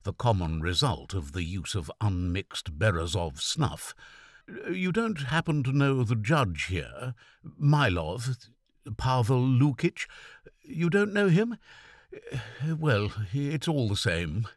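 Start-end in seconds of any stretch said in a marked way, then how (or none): 4.7-14.5 weak, quavering voice. A narrator's reading. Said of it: "in a weak and quavering voice".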